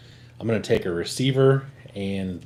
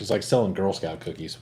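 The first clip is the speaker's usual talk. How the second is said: usual speaking voice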